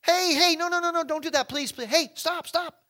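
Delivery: high, loud, frantic pleas; urgent pleading. Acted. Paul begging the jailer to drop the sword, high and urgent. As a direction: beg him to stop.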